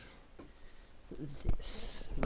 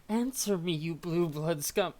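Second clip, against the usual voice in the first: angry demand; 3 semitones higher, 6 dB louder than usual. Acted. Character snarling at the robot maker in anger. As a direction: spit the insult out with scorn.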